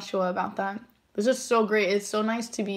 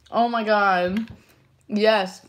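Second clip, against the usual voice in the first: usual speaking voice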